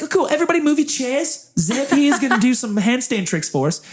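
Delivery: high, announcing bad New Zealand accent; [name]. Interviewer clearing the room for tricks, New Zealand accent. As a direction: address the room in an amateur Kiwi accent. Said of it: "do a very bad New Zealand accent".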